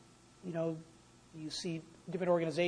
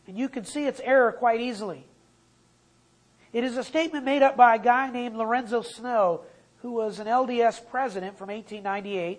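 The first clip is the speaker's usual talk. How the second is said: raised, louder sermon voice; fiery preaching; 3 semitones higher, 7 dB louder than usual. Acted. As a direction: preach it to the church with force. Preaching at full force.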